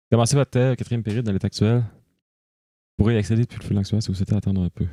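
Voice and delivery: quick rushed read-through; fast reading. Real, not acted. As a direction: skim it aloud quickly. Rushed read of game prompt.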